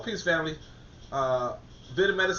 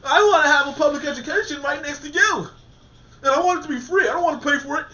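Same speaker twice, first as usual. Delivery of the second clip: raised, louder voice with a whiny edge; hammed-up entitled complaint, pushed higher and louder; mock indignant demand; 5 semitones higher, 5 dB louder than usual. Mocking the listener, voicing their entitled demands in a raised whine. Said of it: "And you saying".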